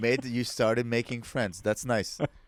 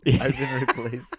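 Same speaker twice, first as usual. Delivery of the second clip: laughed through, slower; chuckling mock lament; laughing; 3 dB louder, slower than usual. Real, not acted. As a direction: say it laughing at yourself. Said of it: "What's that?".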